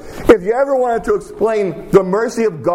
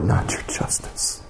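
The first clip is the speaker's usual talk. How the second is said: breathless whisper, lower and much softer; whispered prayer; 11 semitones lower, 8 dB quieter than usual. Pretend. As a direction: murmur the plea under your breath. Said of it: "the only thing we can say is".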